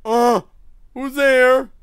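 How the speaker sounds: loud, high scared who's there; frightened call. Real, not acted. Player calling out, scared of the noise. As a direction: call out into the dark in fear. Hears someone in the dark.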